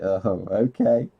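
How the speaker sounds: slow, drawn-out 'oh, okay' of someone put off; awkward unimpressed reply. Retold or imitated. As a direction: imitate a listener who doesn't know what to say. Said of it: "And it's just like".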